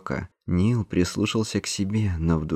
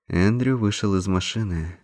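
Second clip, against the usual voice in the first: plain reading voice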